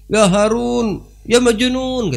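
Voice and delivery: high loud taunt; mocking shout back. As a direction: fling the insult back loudly. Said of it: "Dibalas sama Harun, sama si Buhlul". (balasan ejekan keras tinggi)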